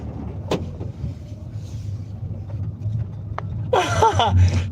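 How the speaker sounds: high, airless wheeze of laughter; wheezing laugh. Real, not acted. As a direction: laugh until no voice is left. Laughing at Richard's comment.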